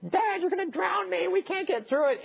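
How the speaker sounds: put-on high, frightened little boy's voice; panicking toddler. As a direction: squeal it like a scared two-year-old. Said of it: "he starts freaking out".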